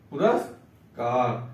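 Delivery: पुरस्कार drawn out syllable by syllable; slow teacher's model reading of पुरस्कार; slow model word. Shown as a demonstration. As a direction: say पुरस्कार very slowly, each syllable clear. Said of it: "पुरस्कार आधा सा होता है".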